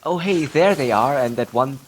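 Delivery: ordinary talk, nothing marked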